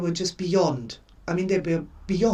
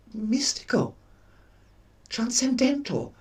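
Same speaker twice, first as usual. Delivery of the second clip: mystical whisper; 5 semitones higher than usual. Pretend. Dreamy goal-setting aside.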